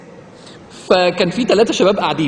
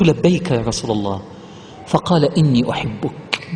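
reverent hadith recitation; 8 semitones lower, 3 dB quieter than usual. Acted. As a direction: recite the Prophet's words with reverence. (تلاوة حديث بخشوع وصوت منخفض)

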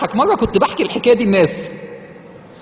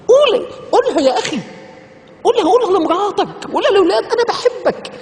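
high, pleading exhortation; passionate urging; 10 semitones higher than usual. Real, not acted. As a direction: urge them warmly to say it. Preacher pleading with audience. (حث حماسي بصوت عالي)

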